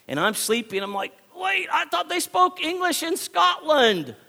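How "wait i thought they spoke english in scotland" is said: high, startled disbelief; a sudden confused yelp, pitched way up; confused surprise. Retold or imitated. Preacher retelling meeting the Scottish kid, startled and confused. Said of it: "so confused".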